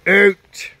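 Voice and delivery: loud sharp order to the dogs; shouted command. Real, not acted. Owner ordering his dogs out of the room, loud shouted command. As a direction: bark the single word like a dog owner.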